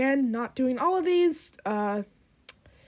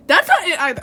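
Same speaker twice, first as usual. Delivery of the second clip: loud, raised exasperated remark; frustrated; 5 semitones higher, 10 dB louder, faster than usual. Real, not acted. Voice actor rejecting a retake, loud exasperation. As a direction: protest your own failed take loudly. Frustrated at second failed take.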